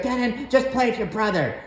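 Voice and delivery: lightly mimicking mom telling him to share; mom's nagging voice. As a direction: whine it like a nagging mother.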